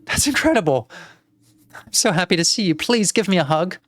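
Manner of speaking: put-on high, delighted little-girl voice; overjoyed girl. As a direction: greet your aunt with excited relief. Plays the girl greeting her aunt.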